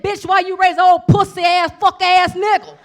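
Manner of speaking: high, loud screaming insult; full-blast rant; furious shout. Retold or imitated. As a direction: yell it at his mother. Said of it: "I said".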